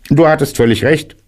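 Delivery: brisk high-pitched admission; rabbit character. A narrator's reading. Rabbit admits bear was right, high rabbit voice. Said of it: "sagte Kaninchen".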